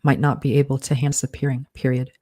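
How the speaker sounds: steady dictation with spoken punctuation; dictating. Acted. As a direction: read it out like dictating a draft. Author dictates novel with punctuation.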